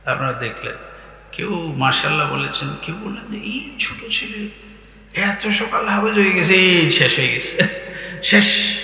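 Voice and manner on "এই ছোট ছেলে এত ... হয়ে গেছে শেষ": mock amazed onlooker, drawn out; retold amazement. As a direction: imitate a gasping admirer. Onlookers' reaction to boy retold. (বিস্ময়ের নকল)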